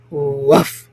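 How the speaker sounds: low mock woof; dog bark. A narrator's reading. The dog answering the baker, low mock bark. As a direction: bark like the big red dog.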